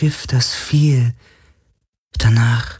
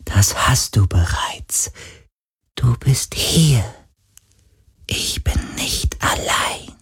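tender whisper; 5 semitones higher, slower than usual. Acted. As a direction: whisper gratefully, fading away. Ghost comforted, fading.

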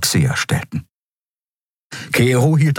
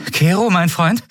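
nervous; 12 semitones higher than usual. Acted. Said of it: "ihr trommelndes Herz unter Kontrolle".

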